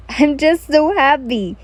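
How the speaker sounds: slow, stretched-out happy exclamation; loud, excited. A narrator's reading. Kojo catching you off guard, loud and excited. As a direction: burst out with joy, drawing it out. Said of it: "his loud, excited voice".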